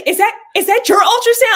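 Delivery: high, loud imitation of an astonished question; retold surprise. Sister reacts to seeing the ultrasound, surprised. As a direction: blurt it out in mimicked disbelief.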